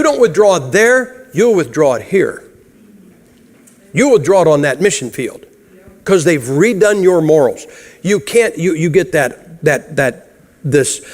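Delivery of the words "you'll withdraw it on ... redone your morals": usual speaking voice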